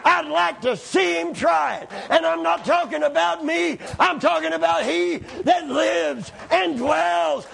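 fervent pulpit shouting; full-force preaching. Acted.

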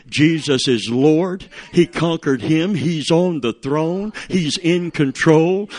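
loud, slow preaching proclamation; slow, loud, rousing sermon climax. Acted. Preaching at full force.

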